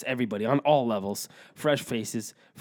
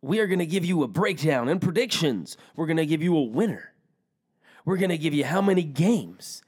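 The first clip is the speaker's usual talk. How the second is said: hyped, higher-pitched playoff announcer; put-on announcer voice; 4 semitones higher than usual. Host kicking off playoff predictions, put-on announcer voice. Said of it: "What's that voice".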